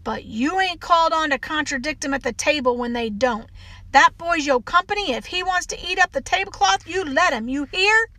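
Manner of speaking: put-on stern Southern housekeeper telling a child off; scolding housekeeper. Housekeeper scolds child over guest.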